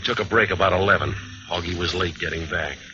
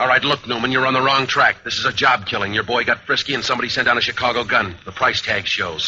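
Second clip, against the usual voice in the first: louder and higher, pushing back hard; heated argument; 6 semitones higher, 5 dB louder than usual. Acted. Bandleader arguing with the detective about the killing, heated. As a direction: tell the cop flatly he's wrong.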